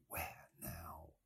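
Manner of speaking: whispered. A narrator's reading. Stephen asking himself in memory, whispered. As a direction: breathe it out unvoiced. Inner thought, hushed.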